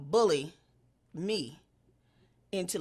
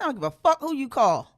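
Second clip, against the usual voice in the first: louder, higher defiant snap; mock defiant shout; 6 semitones higher, 8 dB louder than usual. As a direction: throw it back at her defiantly. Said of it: "I said".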